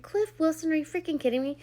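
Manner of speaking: half-laughing disbelief at his nerve; incredulous outburst. Retold or imitated.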